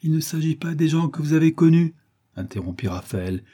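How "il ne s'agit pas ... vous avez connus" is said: agacé. A narrator's reading. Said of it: "agacé par les histoires de Barthelas".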